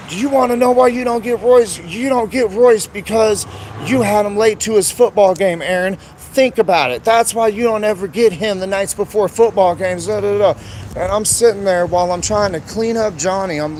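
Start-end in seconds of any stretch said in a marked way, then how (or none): 0.1-10.1 higher and louder, a heated mocking retort; angry retort. Quoting the mother's angry reply. 10.1-10.5 mocking blah blah. Mocking the rest of her rant.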